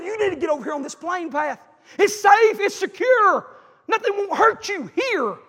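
loud, high, insistent cry; full-force preaching. Acted. Preacher declaring God's call to the safe path, full force. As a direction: proclaim it high and loud, slowing at the end.

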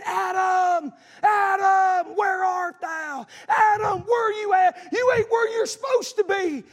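loud, high shout of God calling out, slow on the question; booming call of God in the garden; God calling Adam. Acted. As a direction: call out like a father searching for his son. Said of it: "He's saying".

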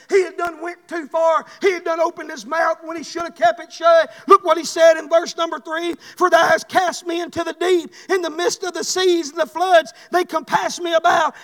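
ordinary talk

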